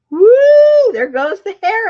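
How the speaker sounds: high loud whoop; excited woo. Retold or imitated. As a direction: let out a big woo. Hair flying off ceiling fan.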